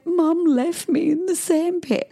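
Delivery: put-on high, drawn-out little-boy whine; imitating crying son. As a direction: whine it like an upset child. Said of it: "last night he was crying".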